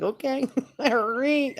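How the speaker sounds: high, slow, laughing okay while backing away; nervous laughing. Retold or imitated. Cornered in the shower by a spirit, nervous laughing.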